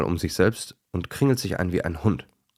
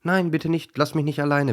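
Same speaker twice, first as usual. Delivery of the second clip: high, pleading little duck voice; pleading duckling; 9 semitones higher, 3 dB louder than usual. A narrator's reading. Lonely duckling begging the dogs to stay, high and pleading. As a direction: beg them not to leave you alone. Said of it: "bittet die Ente".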